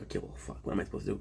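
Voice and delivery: soft, breathless defeated aside; resigned mutter. Retold or imitated. Reacting to daily annoyances, a soft defeated mutter. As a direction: let it slip out under your breath.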